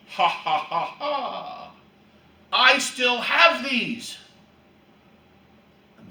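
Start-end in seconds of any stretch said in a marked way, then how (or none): 0.1-4.2 a gloating laugh, then slow smug boast; laugh, then rub it in slowly; mock taunting laugh. English archers taunting the French, with a slow gloating laugh. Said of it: "what would the English do".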